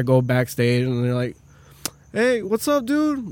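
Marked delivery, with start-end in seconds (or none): 2.1-3.3 imitating broken English. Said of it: "talking their broken English".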